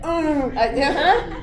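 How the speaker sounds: teasing students who fight their yawns; mock students stifling a yawn. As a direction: mimic the class choking down a yawn. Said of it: "everyone's like".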